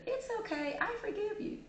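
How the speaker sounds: very high, compliant victim voice; too-easy forgiver. Acted. Victim forgiving the attacker a second time, forgiving. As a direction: pardon it instantly in a high pitch.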